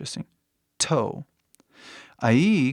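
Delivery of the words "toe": TOE with a hard, aspirated T; strong English T. Shown as a demonstration. Teacher repeats for learners, strong English T in TOE. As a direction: push air through the T of TOE. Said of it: "Bem forte".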